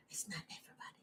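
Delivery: whispered under the breath. Shown as a demonstration. Echoing her own point to herself, whispered. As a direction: hush it to yourself. Repeats line, then whispers it.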